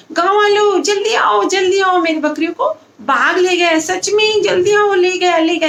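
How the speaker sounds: frantic shout. Acted. Boy fakes a tiger alarm to the villagers, frantic high shout. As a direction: scream for help as if it's real. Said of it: "ऐसे चिल्लाता है".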